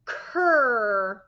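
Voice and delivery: cur, firm blend. Shown as a demonstration. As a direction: put k and er together into cur. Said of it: "put it together".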